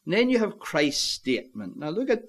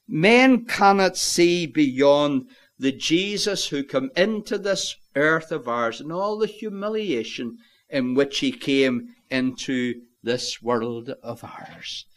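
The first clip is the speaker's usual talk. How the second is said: usual speaking voice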